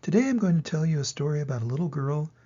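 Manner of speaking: ordinary delivery, nothing marked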